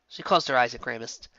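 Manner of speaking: usual speaking voice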